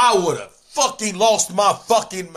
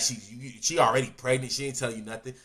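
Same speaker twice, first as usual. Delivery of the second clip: normal talking voice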